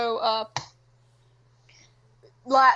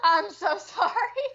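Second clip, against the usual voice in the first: higher, embarrassed laughing apology; 6 semitones higher than usual. Real, not acted. Caught yelling on air, apologizes to host, laughing. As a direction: apologize through real laughter. Mortified at being heard on air.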